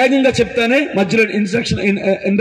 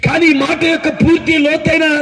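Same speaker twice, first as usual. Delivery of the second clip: raised, ringing preaching; 5 semitones higher than usual. Acted. Preacher challenging the congregation, raised voice. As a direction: press the question on the crowd.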